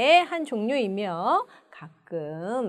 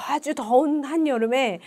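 usual speaking voice

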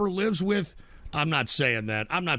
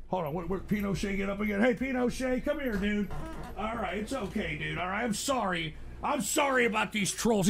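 quiet, gentle apology to the dog; soft coaxing; 6 dB quieter than usual. Real, not acted. Owner apologizing to his dog Pinochet, quiet and gentle. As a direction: soothe him softly.

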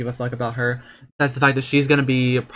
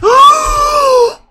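shrieking gasp; 12 dB louder than usual. Real, not acted. Excited shriek at game.

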